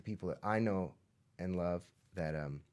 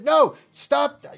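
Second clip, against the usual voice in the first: loud, high snap of frustration; frustrated yell; 16 dB louder than usual. Retold or imitated. Overwhelmed parent yelling stop. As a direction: yell to make it all stop. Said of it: "sometimes I'm like".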